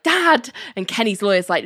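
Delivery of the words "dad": a sharp, higher exasperated cry at his father; a son fed up with his dad, voiced lightly. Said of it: "Kenny's exasperated".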